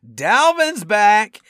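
high, loud, drawn-out reveal; spring it like a gleeful surprise, stretching the words; mock surprise announcement. Game-day news sprung on a stunned fan, mock surprise announcement.

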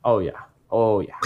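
a hint of Minnesota in "Oh, yeah"; unplanned Minnesota tinge; slight Minnesota accent. Real, not acted. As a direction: let a touch of Minnesota slip out. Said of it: "I turned Minnesota in there".

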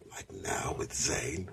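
soft, slow whispered aside. Acted. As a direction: drop to a whisper for the dig. Dad aside about Zayn.

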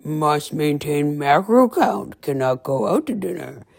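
put-on slow, stiff, clipped-free robot-like delivery of her old rules; robotic old self. Old [name] mocking her strict self to listeners, slow and stiff. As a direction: play her rigid old self like a machine. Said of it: "the old [name] would have been like".

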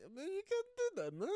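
mock Italian Pope. Friend voices the Pope refusing the king, Italian accent. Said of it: "in, uh, Italian".

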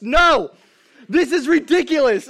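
fed up but cracking up; laughing exasperation. Real, not acted. Fed up with fart contest.

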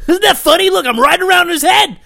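high mocking prank-host voice, gleeful and loud; mock prankster. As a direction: gloat like a giddy prank-show idiot.

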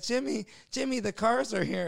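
high-pitched put-on summons; mock calling out. Prankster summons his friend to the cars, calling out.

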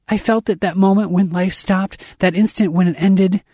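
high, horrified rush of words; screaming panic. A narrator's reading. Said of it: "I'm screaming".

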